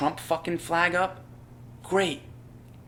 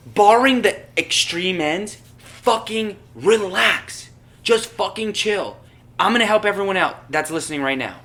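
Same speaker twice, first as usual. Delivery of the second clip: loud, high, emphatic rant; worked up; 5 semitones higher, 6 dB louder than usual. Real, not acted. Podcaster telling listeners to relax, genuinely worked up. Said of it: "the way that I'm getting worked up now".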